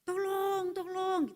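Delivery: high mock cry of tolong; calling for help. Man hanging from a branch calls for help, high-pitched. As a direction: call out for help from the dark. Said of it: "dia mulai berteriak-teriak".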